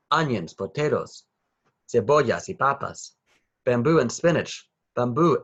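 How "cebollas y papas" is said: ordinary delivery, nothing marked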